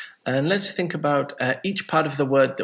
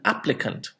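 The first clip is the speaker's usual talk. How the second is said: AP-pli-cant, weight at the front; stress on first syllable; 2 semitones higher than usual. Shown as a demonstration. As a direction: say applicant with the weight on ap. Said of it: "So it changes between two nouns".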